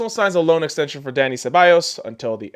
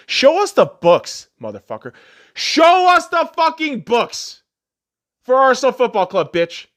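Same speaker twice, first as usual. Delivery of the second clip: angry demand; 6 semitones higher than usual. Real, not acted. Demanding the club's books.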